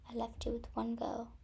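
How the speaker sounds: soft, near-whispered storytelling with long pauses; hushed confession. Acted. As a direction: quietly reveal a painful family secret. Sister confesses their sad past.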